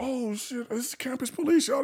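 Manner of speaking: high, alarmed heads-up; alarmed warning. Retold or imitated. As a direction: warn them, alarmed. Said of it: "I'm like".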